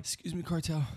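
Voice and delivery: high, strained kidnap-victim voice; pinched voice. Pretend. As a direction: squeak out a scared apology. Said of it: "Kidnap Kid voice?".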